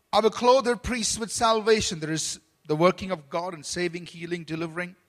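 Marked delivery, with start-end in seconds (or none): 0.1-2.0 solemn scripture reading. Acted. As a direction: proclaim each verse a little louder, like a preacher reading Psalm. Reads scripture aloud.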